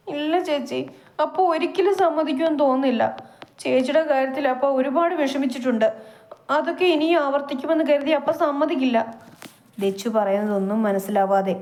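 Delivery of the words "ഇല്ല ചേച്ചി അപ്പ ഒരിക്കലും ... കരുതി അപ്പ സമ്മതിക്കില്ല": put-on higher distressed girl's voice, a touch hurried; upset younger sister. Younger sister insisting father will refuse, distressed. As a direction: plead anxiously in a girl's voice.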